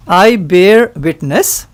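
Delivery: slow, over-enunciated dictation for repeating; said slowly. Shown as a demonstration. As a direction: spell out each word slowly to be repeated. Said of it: "I will say this slowly".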